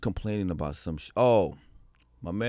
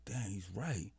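hushed, chastened admission; retold humbled realisation; 13 dB quieter, slower than usual. Speaker takes in his friend's rebuke, quietly humbled. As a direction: drop right down as if conceding to yourself. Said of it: "step back and be like".

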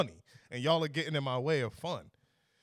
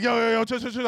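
loud, high imitation of friends stepping in; urgent calming; 7 semitones higher, 8 dB louder than usual. Boys trying to hold back their angry friend, urgent and loud. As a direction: urgently talk a friend down, raised voice.

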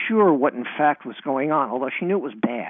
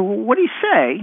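put-on high-pitched, eager kid's question; eager child; 9 semitones higher, 3 dB louder than usual. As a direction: pester like a little kid wanting the answer. Eager child self, retold.

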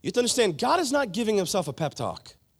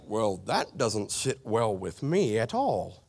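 low, slow mock English accent; a slow, lower, put-on English accent to voice a listener's doubt; 8 semitones lower, 4 dB quieter than usual. Preacher voicing a doubtful listener, in mock English accent. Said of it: "If you talk like that, welcome from England".

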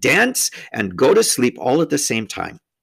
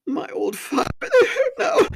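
high voice, rising to a wail; hammed-up weepy lament; tearful faun. A narrator's reading. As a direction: gulp it out through tears. Tumnus still sobbing.

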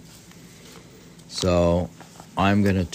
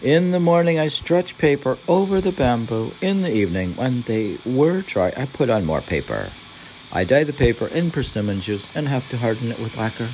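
higher pitched, deliberate reading of prose; podcast host reading Basho's journal, pitched up; literary reading; 8 semitones higher, 5 dB louder, faster than usual. Acted. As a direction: lift the voice into the old text. Reads Basho text aloud.

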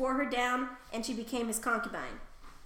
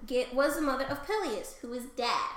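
ordinary delivery

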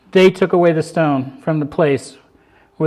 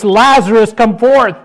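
a loud call, lifted high; loud cry; 6 semitones higher, 8 dB louder than usual. A narrator's reading. Jesus calling Lazarus from the grave, loud cry. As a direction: cry it out across the tomb. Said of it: "he cried out in a loud voice".

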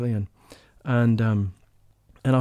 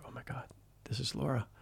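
breathless whispered recognition; awed whisper; 15 dB quieter than usual. Retold or imitated. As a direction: hush it as if to yourself, stunned. Inner thought on recognising her.

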